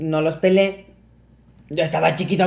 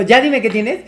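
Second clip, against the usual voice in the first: higher, louder voicing of an insistent mother; mamá exigente imitada; 5 semitones higher, 4 dB louder than usual. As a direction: press for an answer, mock-motherly. Mother's insistence repeated, stronger. (mamá insistiendo, imitada)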